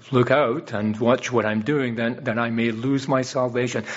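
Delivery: normal talking voice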